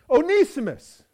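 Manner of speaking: high, loud furious cry of the name; angry shout. Acted. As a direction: roar his name in outrage. Master confronts returning runaway slave.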